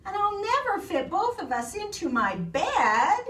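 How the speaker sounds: high storybook character voice; high, dismayed complaint; Eddie's dismay. A narrator's reading. Eddie worrying about fitting in bed, dismayed.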